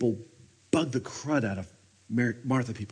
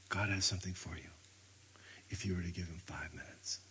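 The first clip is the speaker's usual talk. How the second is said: much softer, near-whispered preaching; hushed whisper; 6 semitones lower, 13 dB quieter than usual. Real, not acted. Preacher urging listeners to sit with God, whispered. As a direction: draw them in with a hush. Preacher drops to intimate hush.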